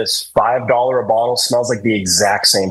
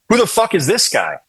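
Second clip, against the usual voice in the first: higher-pitched mock affront; retold hostile challenge; 7 semitones higher than usual. Eric opens the van door on a stranger, mock hostile challenge. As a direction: imitate a rude demand, pitched up. Acts out friend's hostile first greeting.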